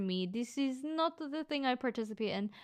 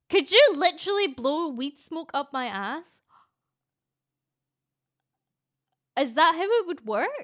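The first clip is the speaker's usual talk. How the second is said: loud, higher, disbelieving question; louder, high, stunned by the idea; incredulous loud; 5 semitones higher, 9 dB louder than usual. Real, not acted. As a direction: shout the question in amazed disbelief. Shocked question at chat.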